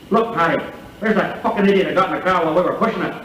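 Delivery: an indignant Irishman spotting the idiot; Irish pub punchline. Retold or imitated. Irish punchline quote, other hiker.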